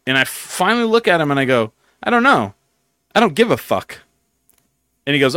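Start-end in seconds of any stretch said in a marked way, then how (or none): 2.0-4.0 sharp defiant comeback, higher; defiant retort. Retold or imitated. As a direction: toss it back with defiance. Retorting to rude old guy.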